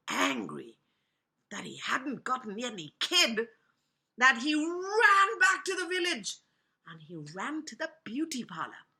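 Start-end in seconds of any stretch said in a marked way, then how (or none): none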